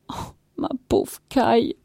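high, whimpering moan; tearful lament. A narrator's reading. Wife grieving for her cow, tearful. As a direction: moan it as if crying.

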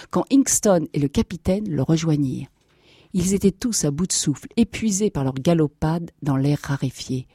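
usual speaking voice

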